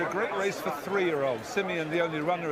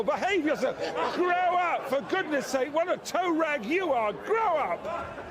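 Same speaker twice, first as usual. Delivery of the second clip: wrestling manager; 9 semitones higher than usual. Real, not acted. Scolding crowd mid-commentary.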